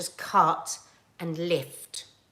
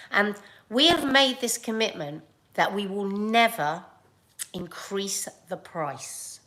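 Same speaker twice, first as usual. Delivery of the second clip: ordinary talk, nothing marked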